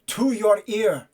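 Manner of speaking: loud, drawn-out soldier's instruction; urgent calling out. Acted. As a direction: spell it out slowly over battle noise. Radio man mouths loudly amid battle.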